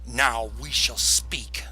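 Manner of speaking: loud, slow, commanding; grand villain decree. Acted. Velandar proclaiming to his student, slow and loud. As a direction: declare it slowly like a master. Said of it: "Velandar says".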